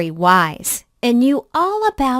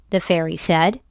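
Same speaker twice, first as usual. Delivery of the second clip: ordinary delivery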